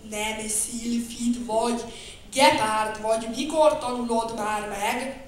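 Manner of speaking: slow, a touch annoyed; mild irritation. Acted. Bear scolds the cheetah, mildly annoyed. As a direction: scold him lightly, losing patience. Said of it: "mérgelődött Medvemedár".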